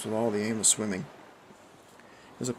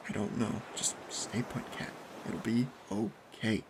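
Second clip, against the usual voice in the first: hushed reassurance; 7 dB quieter, slower than usual. A narrator's reading. Subdued friend reassuring in dark.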